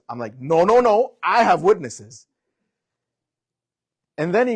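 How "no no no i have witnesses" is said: loud insistence. Retold or imitated. Cop and speaker shouting back and forth.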